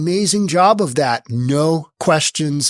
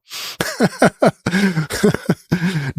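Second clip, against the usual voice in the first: laughing at himself; 2 semitones higher than usual. Real, not acted. Laughing at his bad Spanish.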